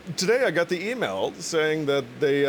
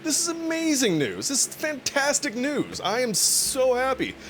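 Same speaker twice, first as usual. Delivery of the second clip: much higher, thrilled squeal of good news; overjoyed; 11 semitones higher than usual. Retold or imitated. Retelling his reaction to free repair, overjoyed. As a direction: gush like you just won. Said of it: "I'm like".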